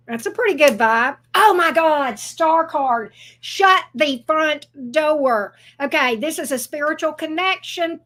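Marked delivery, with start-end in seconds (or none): 1.3-5.5 astonished, louder, then slowing right down; amazed exclamation. Real, not acted. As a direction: exclaim it in delighted disbelief, word by word at the end. Amazed at the Star card.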